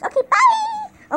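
high, drawn-out singsong bye; sing-song goodbye. Real, not acted. Leaving car behind.

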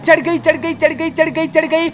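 high, fast excited chant of चढ़ गई; gleeful chant as the truck climbs; excited cheering. Real, not acted. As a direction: cheer the truck over the top.

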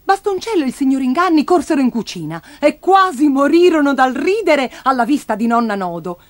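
plain reading voice

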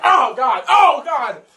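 rising pained shouts; pained shouting. Real, not acted. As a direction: shout through the burning. Burning mouth.

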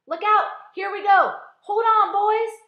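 very loud, high yells; excited shouting. A narrator's reading. Crew bracing as the peach rises, shouting. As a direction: yell warnings at the top of your voice.

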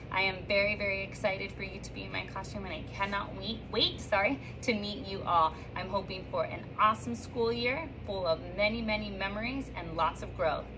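normal talking voice

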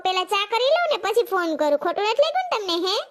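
usual speaking voice

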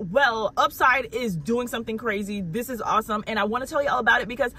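bubbly, eager announcement to followers; can't wait to share the news; excited. Real, not acted. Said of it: "I'm so excited".

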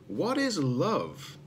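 slow, wondering robot question; curious young robot. Acted. Young robot Zed wondering in bed, slow and curious. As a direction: ask it slowly, wide-eyed. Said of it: "asked the young robot".

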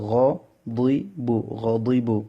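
দুই with a thick, emphatic Arabic Dad; heavy DAD. Shown as a demonstration. Teacher drills the word for students, thick দুই in গ দুই বু. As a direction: make the দুই in গ দুই বু heavy and full. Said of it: "দুই মোটা হবে".

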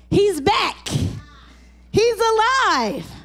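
high, slow amazed reveal; excited. Acted. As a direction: lift it up with wonder. Resurrection reveal.

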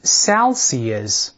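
"Celsius" read slowly and clearly, SEL-see-us; model reading. Shown as a demonstration. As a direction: say "Celsius" carefully for the class. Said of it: "我们再读一下这同义词". (示范朗读"Celsius")

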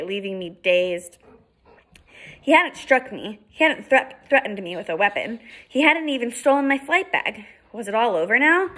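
ordinary delivery, nothing marked

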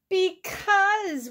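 high, stretched-out wail. A narrator's reading. Toad answering Frog's why not, wailing. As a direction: wail it like a distressed storybook toad. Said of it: "wailed Toad".

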